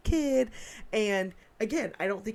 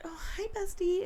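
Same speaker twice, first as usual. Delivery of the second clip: high, put-on bestie greeting; high-voiced phony hello; fake friend; 12 semitones higher, 3 dB quieter than usual. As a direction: mimic a fake pal greeting everyone, pitched way up. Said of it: "A fake person will go around".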